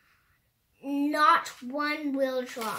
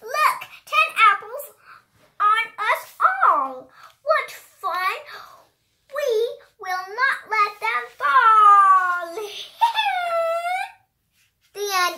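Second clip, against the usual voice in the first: high, lively storybook read-aloud; reading to children; 8 semitones higher, 10 dB louder than usual. Acted. A child reading a rhyming book aloud, high and animated. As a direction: bounce through the rhymes like a picture book.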